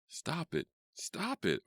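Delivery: very high, contained shriek. A narrator's reading. Worker covering ears against animal cries, shrieking. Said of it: "I shrieked".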